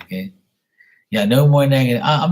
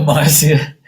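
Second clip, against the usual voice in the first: bursts into high laughter while greeting Marcia; real laugh, then a breathy high giggle; 7 semitones higher, 5 dB louder than usual. Real, not acted. Laughing at a viewer's comment.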